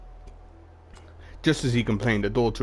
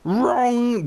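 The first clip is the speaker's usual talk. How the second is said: much higher, louder held shriek; Junkrat yell retry; 13 semitones higher, 5 dB louder than usual. Acted. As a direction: try the Junkrat buzzer shout again. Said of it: "used to have a really good Junkrat impression".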